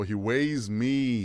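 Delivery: slowly echoing the answer; drawn out in disbelief; slow, puzzled repeat. Real, not acted. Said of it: "I thought you meant weigh".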